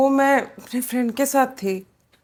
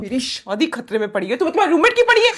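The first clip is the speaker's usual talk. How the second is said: indignant, rising to shouting; angry outburst; 3 dB louder, faster than usual. Acted. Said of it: "चिल्लाओ तो मत कम से कम".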